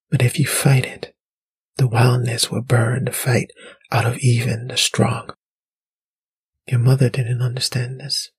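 eerie voice of the wildness; soft mysterious voice. A narrator's reading. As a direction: whisper it portentously, with long pauses. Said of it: "soft, like a woman's, rumbling".

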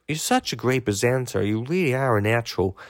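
mock American accent, quietly complimenting. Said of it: "excuse the American accent, everybody".

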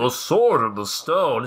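low, slow solemn intonation; mock grand announcer. Host echoing the crowd in a grand voice. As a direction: intone it like a movie trailer.

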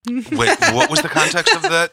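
high, laughing disbelief. Real, not acted. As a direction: laugh through the question. Laughing at the Enron note.

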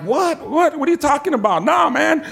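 high, incredulous mock protest; flustered disbelief. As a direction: overlap the questions like a cornered husband. Retold flustered phone argument.

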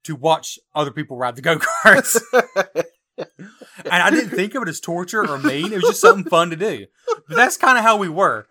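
laughing hard through the words. Real, not acted. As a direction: talk while cracking up. Laughing while telling beach story.

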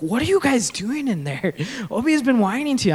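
fast, alarmed mom calling in; worried wife. Retold or imitated. Wife calling into the room about the whining son, fast. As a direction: call out, fretting and hurried. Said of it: "She's like".

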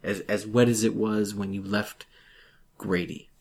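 ordinary talk

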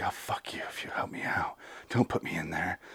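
very soft, whispered retelling; hushed plea. Retold or imitated. Woman sweet-talking her captor for help, hushed plea. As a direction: whisper it low, as if coaxing.

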